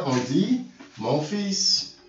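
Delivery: "mon fils" in French, slow and deliberate. Shown as a demonstration. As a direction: spell out "mon fils" slowly. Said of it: "En français". ("mon fils" lentement)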